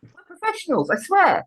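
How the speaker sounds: high-pitched comic protest; mock indignation. Real, not acted. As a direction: plead it in a high, put-upon voice.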